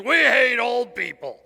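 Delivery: slow, loud mock shout. Mocking a spiked-hair grandchild, shouting. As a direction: bellow the tattoo like a rebel. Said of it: "tattooed on their chest that says".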